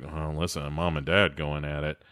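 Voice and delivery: low, soft overheard-argument commentary; hushed kids' aside. Retold or imitated. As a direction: drop it low and quiet, like kids listening in. Kids overhearing parents argue.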